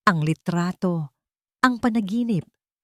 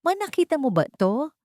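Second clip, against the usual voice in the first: stunned question; 9 semitones higher than usual. A narrator's reading. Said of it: "tanong ni Xavier".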